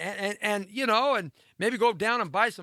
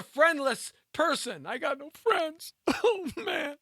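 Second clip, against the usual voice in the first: high-pitched put-on whimper; hammed-up poor me; mock self-pity; 10 semitones higher, slower than usual. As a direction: lament it in a high, pouty mock-sad voice. Mock lament about being friendless.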